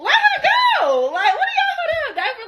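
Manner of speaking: very high-pitched yell; exasperated high shout of protest. Retold or imitated. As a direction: holler it out, voice way up. Acted shouting to release the woman.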